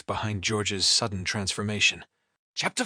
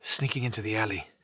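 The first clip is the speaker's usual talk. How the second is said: ordinary delivery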